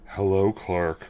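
low, soft "Hello, Clark"; a mock-suave boy's greeting; mock deep kid voice. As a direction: drop deep and hush for the kid. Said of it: "Hi, [name]".

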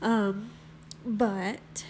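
congested, cold-stuffed voice; stuffy nose. Real, not acted. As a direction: talk through a head cold. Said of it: "if I sound like I have a cold".

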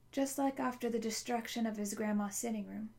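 ordinary delivery

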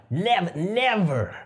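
slow, emphatic never, never; drawn-out never. Retold or imitated. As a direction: stretch out each never firmly. Retold vow to girlfriend.

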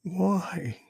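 put-on high, small child's voice; boy's almost whisper. A narrator's reading. Tearful son answering his mother, almost whispering. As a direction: ask it as a little boy, plaintively hushed. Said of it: "almost whisper".

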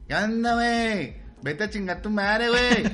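northern Mexican accent. Shown as a demonstration. Northerner greeting a friend, slow high singsong northern Mexican accent. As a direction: ham up a northerner's lilt. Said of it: "tú que vienes del norte, dices".

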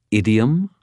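put-on slight British accent, rising high into a question. A narrator's reading. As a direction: ask it with a touch of British. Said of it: "a very slight British accent".

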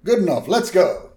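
lightly voiced decisive traveler. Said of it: "[name] walks up".

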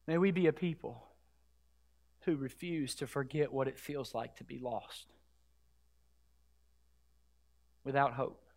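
low, very soft and solemn; hushed exhortation. Real, not acted. Preacher pleading with the church to remember, hushed exhortation. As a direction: let the voice sink gently with pauses.

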